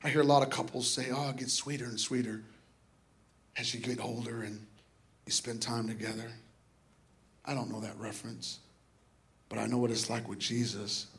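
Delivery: soft, low, intimate musing; tender reflection. Real, not acted. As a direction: muse quietly and fondly, dropping low. Intimate reflection on loving Jesus.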